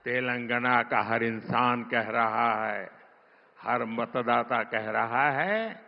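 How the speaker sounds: ordinary delivery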